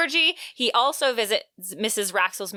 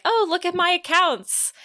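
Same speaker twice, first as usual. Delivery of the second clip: sister's exclamation; 8 semitones higher than usual. Retold or imitated. Podcaster retelling what the sister told Oliver, higher mock exclamation. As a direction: lightly act out her eager request, pitched up.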